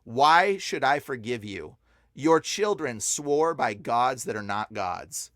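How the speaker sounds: slow, weighty scripture quotation; measured, grave read-out; solemn verse. Retold or imitated. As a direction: let each word land slowly as a rebuke. Reads out God's rebuke verse.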